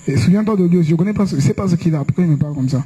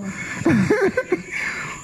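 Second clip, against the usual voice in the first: high breathy laugh; 10 semitones higher, 7 dB quieter than usual. Real, not acted. Laughs at own joke.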